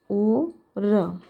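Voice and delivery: normal talking voice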